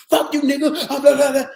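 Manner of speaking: loud, high mock cursing; mock inmate yell. Inmate cursing at the calm teacher, loud and high. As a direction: hurl the insult like an angry inmate.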